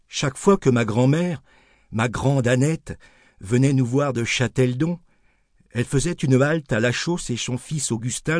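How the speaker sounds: ordinary delivery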